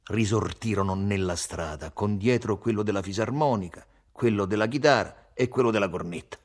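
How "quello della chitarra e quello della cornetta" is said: plain reading voice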